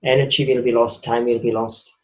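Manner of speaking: ordinary delivery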